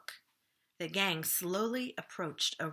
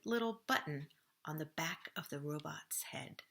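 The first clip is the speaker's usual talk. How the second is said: whispered, softer; hushed suspense; 3 semitones lower, 6 dB quieter than usual. A narrator's reading. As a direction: drop to a tense whisper. Suspense before the click.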